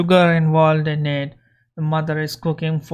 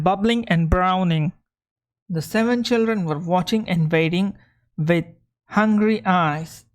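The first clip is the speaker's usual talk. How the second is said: reading a fairy tale aloud to the class; lively storybook read for learners; story reading. Acted. Teacher reading a story to students, lively storybook voice.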